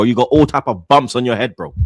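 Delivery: ordinary talk, nothing marked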